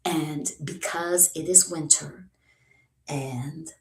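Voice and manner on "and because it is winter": normal talking voice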